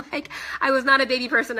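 giggling through the confession; laughing. Real, not acted. Admitting to viewers she disliked babies, laughing. Laughing at her past self.

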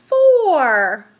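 big, loud drawn-out reveal; loud announcement. Real, not acted. Reader revealing the next number to children, loud. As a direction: boom the number out. Answers own counting question, emphatically.

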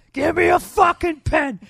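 put-on loud, high, slow drunken yell; drunken shout. Host acting out the drunk letter writer, slow drunken shout. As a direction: bellow it like a hammered guy at the bar. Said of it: "said loudly".

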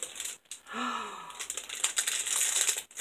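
long breathy gasp that falls away; delighted gasp. Real, not acted. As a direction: gasp at something gorgeous. Gasp at paper flowers.

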